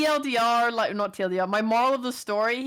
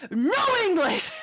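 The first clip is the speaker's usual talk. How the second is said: mock yell; 11 semitones higher, 4 dB louder than usual. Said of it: "she raised her voice at me".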